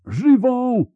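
very high, loud howl; frantic high yell; howled shout. A narrator's reading. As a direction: howl the command at the top of your pitch. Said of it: "zawył Riens".

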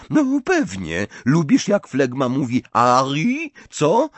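high, loud teasing jibe; mocking. A narrator's reading. Ginny needling Harry about Fleur, mockingly. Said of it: "zapytała drwiąco Ginny".

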